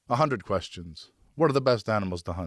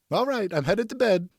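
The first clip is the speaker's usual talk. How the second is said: put-on much higher, eager young son; boy's voice; 12 semitones higher than usual. Son asks his father for a moment, in a high boyish voice. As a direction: voice the boy hopeful and polite.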